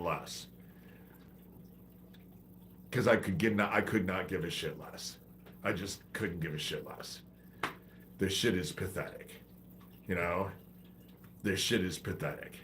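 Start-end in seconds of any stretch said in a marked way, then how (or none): none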